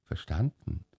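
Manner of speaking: soft, doubtful repeat of Verstanden; puzzled quiet echo. A narrator's reading. Old Tag repeats the hussar's German quietly, puzzled. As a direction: murmur the foreign word back, lost. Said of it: "repeating hussar".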